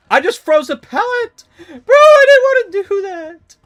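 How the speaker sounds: high, very loud shriek of dismay; anguished scream. Real, not acted. Streamer shrieking after freezing the pallet by mistake. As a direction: scream it like the game just betrayed you. Screams at his own misplay.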